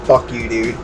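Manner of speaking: ordinary delivery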